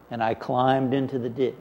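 normal talking voice

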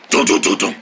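ordinary talk

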